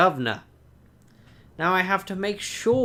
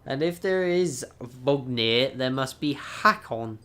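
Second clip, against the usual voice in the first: normal talking voice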